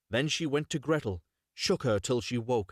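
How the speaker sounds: ordinary delivery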